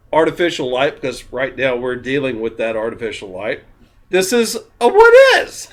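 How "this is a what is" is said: laughing. Real, not acted. Giggling tipsy aside.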